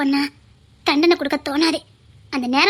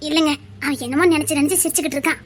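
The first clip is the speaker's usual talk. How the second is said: ordinary talk, nothing marked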